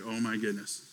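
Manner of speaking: imitating someone quietly taken aback; low, very soft retold reaction; hushed realization. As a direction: drop to a stunned murmur. Retold man quietly struck by realization.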